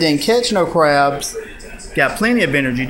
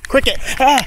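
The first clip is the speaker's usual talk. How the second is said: startled call; 10 semitones higher, faster than usual. Real, not acted. Dog about to be bitten.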